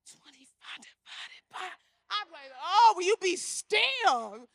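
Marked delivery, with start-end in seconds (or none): 0.1-1.7 very soft, breath-only mimicry; hushed acted echo of the question; whispered repeat. Same question repeated, acted in class. 2.6-4.5 loud, high, drawn-out mock scolding; acted teacher at wit's end, slow and loud; exasperated scolding. Acts exasperated teacher scolding restless pupil.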